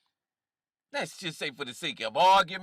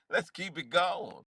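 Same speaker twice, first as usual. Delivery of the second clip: ordinary delivery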